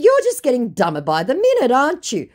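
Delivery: high, loud mocking question; taunting. Woman taunting the scammer, mock incredulous. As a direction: needle them with the tag question.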